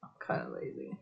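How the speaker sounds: very soft, low aside; quiet mumble. Real, not acted. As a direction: admit it almost inaudibly to yourself. Admits it, then sings it.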